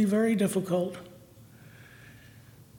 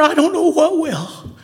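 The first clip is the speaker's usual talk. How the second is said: tearful, raised pleading cry; emotional; 5 semitones higher, 3 dB louder than usual. Real, not acted. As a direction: let the voice crack on it. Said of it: "I'm sorry I'm so, so emotional".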